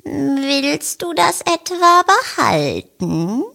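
slow, stern question; stern cat. A narrator's reading. Lucia the cat challenging the hen, stern and slow. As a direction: ask it slowly and sternly. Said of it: "fragt sie streng".

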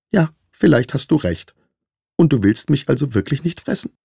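high-pitched little mouse, tentative; piepsende Maus. A narrator's reading. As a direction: ask as a small squeaky mouse seeking reassurance. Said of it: "Die Maus schluckte und piepste schließlich". (hohe Mäusestimme, vorsichtig fragend)

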